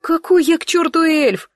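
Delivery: a scornful snort of a question, pitched high; scoffing. A narrator's reading. The woman scoffs at the boy's idea, scoffing. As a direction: scoff at being called an elf. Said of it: "фыркнула я".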